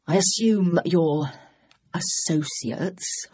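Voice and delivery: slow, drawn-out mocking stress on associates; mocking emphasis. A narrator's reading. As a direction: lean on associates like it's a joke. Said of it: "Maddox put mocking emphasis on the word".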